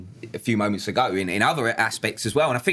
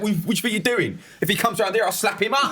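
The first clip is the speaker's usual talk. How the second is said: gruff overprotective dad; 10 semitones higher, faster than usual. Retold or imitated. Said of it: "with the daughter, it's like".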